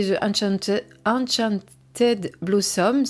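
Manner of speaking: halting English title in a French accent. Real, not acted. Reviewer giving the original title to viewers, French-accented English. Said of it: "faites pas attention à mon accent anglais".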